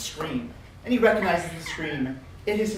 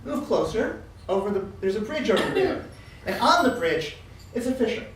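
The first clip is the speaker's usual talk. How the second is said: usual speaking voice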